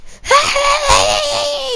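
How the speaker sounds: high shrieking laugh-yell; mock scream-laugh. Imitating a mad teacher, high mock shriek. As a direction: let out a shrill mock shriek.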